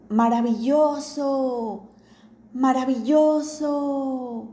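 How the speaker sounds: slow, ringing repeat of the shout; the cave echoes the father's shout back. Acted. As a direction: let it come back from the cave walls. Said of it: "Y el eco repitió".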